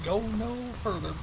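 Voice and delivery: weak, soft attempt at the voice; failed character voice. Shown as a demonstration. Streamer failing to redo the character voice on command. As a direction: try the voice but it fizzles. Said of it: "I can't do it on command".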